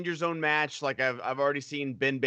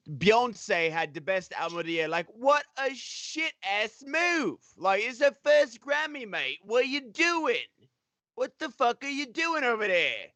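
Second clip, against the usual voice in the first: mock British-Australian rant, pitched higher; British Australian accent; 6 semitones higher than usual. To the stream, ranting about [name] in a British-Australian accent. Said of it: "I'm going a little Australian".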